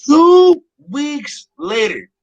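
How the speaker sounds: high, loud, drawn-out announcement; mock time-card announcer. Storyteller marks a jump in the story, mock announcer. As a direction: stretch each word like a cartoon time card.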